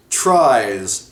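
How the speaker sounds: TRIES drawn out long and slow; stress on TRIES. Real, not acted. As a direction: lean hard on the word "tries", stretching it. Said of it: "emphasis on tries".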